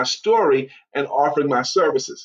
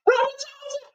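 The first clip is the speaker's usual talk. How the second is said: squealed far higher, starstruck; excited fan greeting; 5 dB quieter than usual. Retold or imitated. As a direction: greet him like a thrilled fan spotting a star. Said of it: "people are like".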